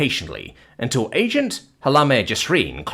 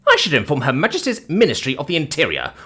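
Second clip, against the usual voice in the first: high-pitched officious indignation; affronted society matron; haughty dame threatening; 5 semitones higher than usual. Acted. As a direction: threaten to report him, grandly.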